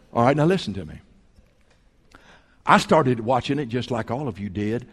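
usual speaking voice

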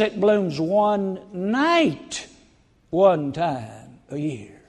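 one night, one time a year drawn out very slowly; slow emphasis. Real, not acted. Preacher telling congregation the rare bloom, slow and deliberate. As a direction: stretch "one night" out slowly, each word weighed.

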